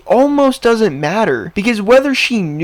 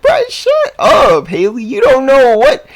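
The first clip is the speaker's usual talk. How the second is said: shout at Haley; 8 semitones higher, 4 dB louder than usual. Real, not acted. Vlogger yelling at Haley over the apology, much higher and louder. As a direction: snap at her like she's lying.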